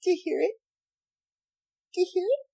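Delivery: mimicking the dryer beep, high and light; playful high-pitched sing-along; high singsong. Real, not acted. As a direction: sing it like a little machine chime.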